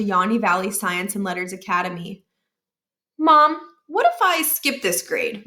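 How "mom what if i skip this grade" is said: put-on higher, slow boyish pleading; a kid angling to skip school; young Miles. A narrator's reading. Miles voiced, child asking.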